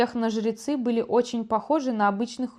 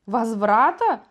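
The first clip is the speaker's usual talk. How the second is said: "Возврата?" called out louder with a falling end; "Возврата?" as a startled, louder exclamation; exclaiming; 5 dB louder, slower than usual. A narrator's reading. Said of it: "воскликнула дознаватель Хокиспур".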